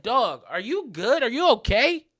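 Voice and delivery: retold taken-aback challenge; incredulous check-in. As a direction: ask as if he has lost it. Acted reply to screaming stranger.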